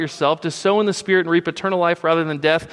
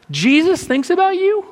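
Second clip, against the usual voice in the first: voice gone high; 13 semitones higher, 5 dB louder than usual. Real, not acted. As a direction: voice jumps up high and loud. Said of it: "My voice got a little high".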